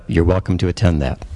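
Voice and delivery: ordinary talk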